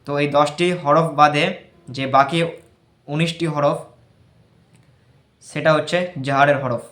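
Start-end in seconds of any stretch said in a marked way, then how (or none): none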